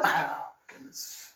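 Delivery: slow, breathy under-the-breath sigh of words; whispered aside. Real, not acted. Preacher whispering after imitating his wife. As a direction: murmur it to yourself, unvoiced.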